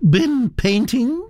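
put-on high, gossipy old aristocrat voice, pitched well up; elderly Duchess. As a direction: chatter on like a grand old lady.

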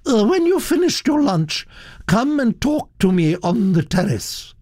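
high, commanding Duchess; haughty summons, pitched high; imperious order. Acted. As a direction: hand down an order as a grand lady. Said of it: "ordered the Duchess".

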